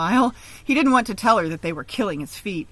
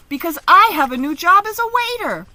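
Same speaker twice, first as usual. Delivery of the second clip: much higher, louder proud news; proud announcement; 10 semitones higher, 9 dB louder than usual. Acted. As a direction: announce it to the whole table with pride. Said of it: "Uncle announced".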